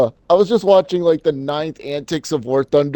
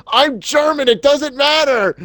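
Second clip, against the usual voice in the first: goofy character voice; 12 semitones higher, slower than usual. Retold or imitated. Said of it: "the guy that just responds with".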